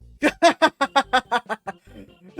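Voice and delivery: loud, long belly laugh; big laugh. Acted. Player laughing at his rival, uproarious. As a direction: burst out in rapid, loud ha-ha-ha. Character bursts out laughing at game.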